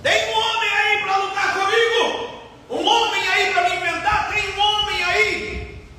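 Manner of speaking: high mocking challenge called out to the army; Goliath's taunting cry. Goliath mocks the frightened army with a high taunting cry. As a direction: taunt like a giant sure no one will come. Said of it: "Qual era o grito de Golias".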